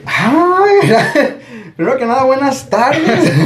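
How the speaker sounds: réplica muy aguda, entre risas; cortesía ofendida fingida. Retold or imitated. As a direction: exagera los buenos modales con voz aguda. Responde actuado al comentario de la amiga. (cortesía fingida, ofendido en broma)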